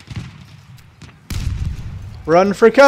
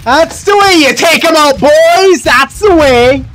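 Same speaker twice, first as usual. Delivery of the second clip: triumphant; 6 semitones higher, 3 dB louder than usual. Real, not acted. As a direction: cheer the squad on. Celebrating a kill.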